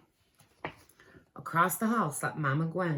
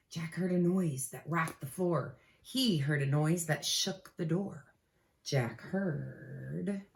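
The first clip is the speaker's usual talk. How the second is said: ordinary delivery, nothing marked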